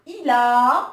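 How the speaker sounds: il a with the final a held long; loud, drawn-out a. Shown as a demonstration. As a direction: stretch the a of il a. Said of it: "on voit bien la voyelle longue".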